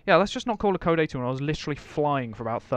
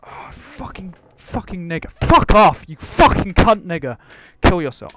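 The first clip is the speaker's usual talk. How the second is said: swearing tirade; 5 semitones higher, 15 dB louder than usual. Real, not acted. Car wrecked, raging at other driver.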